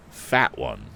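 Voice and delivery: usual speaking voice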